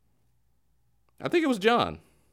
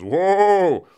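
mock pioneer calling horses; 5 semitones higher, 5 dB louder than usual. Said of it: "pioneers ... settle down their horses".

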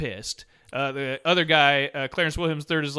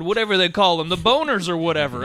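mock dudes talking; 6 semitones higher than usual. Retelling guys in porn scene.

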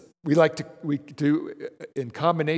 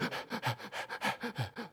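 quick, airy panicked gasps; shallow gasping; 11 dB quieter than usual. Retold or imitated. Mimicking the stressed little kid, airy panicked gasps. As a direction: imitate a kid gasping for air. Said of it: "He's going".

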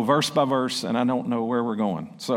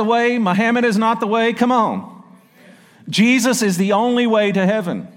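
loud, higher preacher's cry on every clause; preaching at full force; 7 semitones higher, 6 dB louder than usual. Acted. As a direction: proclaim it to the whole church.

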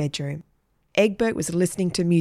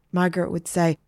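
ordinary delivery, nothing marked

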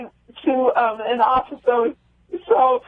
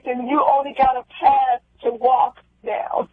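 ordinary talk, nothing marked

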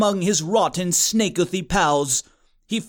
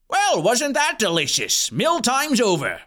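higher, cheery announcer voice; sing-song principal; 4 semitones higher than usual. A narrator's reading. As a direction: chirp it to the whole room.

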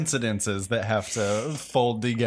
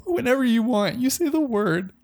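much higher put-on voice, coy; seductive mirror reflection; 12 semitones higher than usual. The reflection answers his longing, coy and high-pitched. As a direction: purr it like a coy lover behind the glass.

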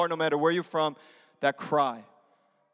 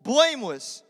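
loud, driving altar-call preaching; preaching at full force; 7 dB louder than usual. Acted. Pastor urging the congregation to accept Jesus, full-force preaching. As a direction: press the room hard toward a decision.

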